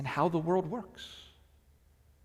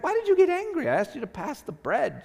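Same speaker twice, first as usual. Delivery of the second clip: high, loud, incredulous question; can't believe they blew up over bread; baffled protest; 12 semitones higher, 3 dB louder than usual. Retold or imitated. The dinner partner asking why, incredulous. Bewildered reply to angry person.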